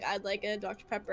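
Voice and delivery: low, subdued customer reply; drive-thru customer. Retold or imitated. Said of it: "they'd be like".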